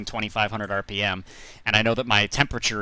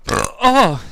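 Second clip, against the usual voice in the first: burp; 13 semitones higher, 11 dB louder than usual. Real, not acted. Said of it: "I'm burping like a".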